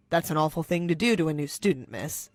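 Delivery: low, firm fatherly reproach; stern dad. Acted. Janitor dad scolding the bully, low and firm. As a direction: calmly put the rich girl in her place.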